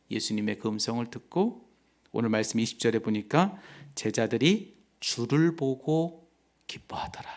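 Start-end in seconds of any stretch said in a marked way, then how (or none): 4.0-7.3 hushed scripture reading. Acted.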